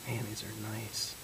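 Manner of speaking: hushed admiring whisper. Real, not acted. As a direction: breathe it, barely voiced. Unvoiced hushed remark.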